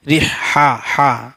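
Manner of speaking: ordinary talk, nothing marked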